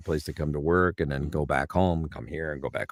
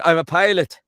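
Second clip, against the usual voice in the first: high, loud Newfoundland blurt; bold, high-pitched Newfoundlander bluff; Newfoundland accent; 10 semitones higher, 8 dB louder than usual. Acted. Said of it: "jump the pond over to Newfoundland".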